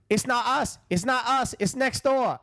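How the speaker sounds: high, insistent denial; protesting son. Retold or imitated. Neighbor's son before anyone can knock, high-pitched protest. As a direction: mimic a kid pleading innocence, pitched well up.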